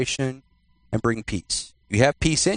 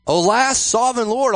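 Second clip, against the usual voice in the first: higher-pitched cry of alarm; mock freaking out; 8 semitones higher than usual. Preacher acts Gideon facing the angel, mock panicked exclamation. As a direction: exclaim it high, acting panicked. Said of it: "he's freaking out".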